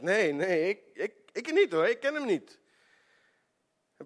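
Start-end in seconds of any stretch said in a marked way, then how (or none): 0.0-2.6 high, loud, stammering panic; startled denial. Retold or imitated. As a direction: deny it in a fright. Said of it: "Petrus die schrikt".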